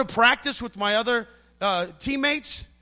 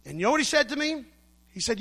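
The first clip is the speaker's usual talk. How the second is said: usual speaking voice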